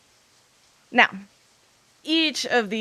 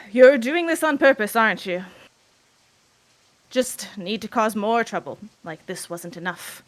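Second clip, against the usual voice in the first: bitter accusing father; 6 dB louder than usual. Acted.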